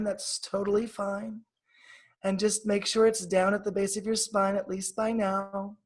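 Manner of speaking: guided meditation. Acted. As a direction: ease her down into calm, breath by breath.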